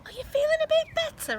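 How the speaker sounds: put-on very high child's voice asking; child's question. A narrator's reading. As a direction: ask mum sweetly. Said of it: "child asks mum".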